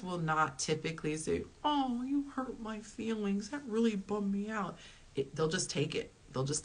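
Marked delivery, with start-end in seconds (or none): 1.6-4.8 high, drawn-out mock wounded complaint; mock hurt feelings. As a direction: act wounded in a high, slow voice. Mock quote of what guys never say.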